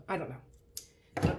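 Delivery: ordinary delivery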